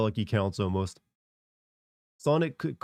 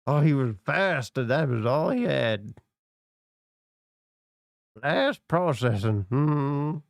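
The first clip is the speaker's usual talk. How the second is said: slow, lightly voiced old man; a hint of a grumbling grandpa; 3 semitones higher, slower than usual. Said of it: "My old man voice".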